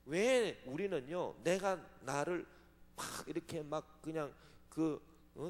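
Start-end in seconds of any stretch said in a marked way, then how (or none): none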